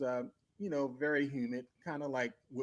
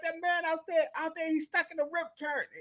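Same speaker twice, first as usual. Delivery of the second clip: high, fast, urgent telling; urgent explaining; 4 dB louder than usual. Retold or imitated. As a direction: point him out in a rush. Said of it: "I'm like".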